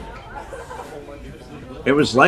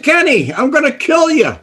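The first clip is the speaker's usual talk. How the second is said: imitating Sam's threat; 12 semitones higher than usual. Mob boss Sam threatening Kenny in the basement, hammed-up rage. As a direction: ham up a raging mobster.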